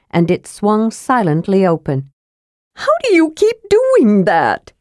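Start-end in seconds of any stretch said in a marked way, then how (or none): 2.7-4.7 high-pitched kender wail; Tasselhoff wailing. A narrator's reading. Kender baffled by the dwarf opening doors, high wail. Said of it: "Tasselhoff wailed".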